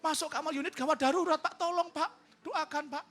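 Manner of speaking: high, pleading mother's voice; imitated anxious mother on a call; distressed mother phoning. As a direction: plead urgently for prayer. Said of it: "mamanya telepon saya".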